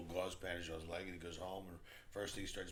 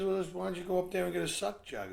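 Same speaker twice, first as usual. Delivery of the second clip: high, loud acted suggestion; pushy concierge; 10 semitones higher, 10 dB louder than usual. Concierge still pressing the reluctant groom, loud and insistent. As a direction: insist loudly with rising impatience.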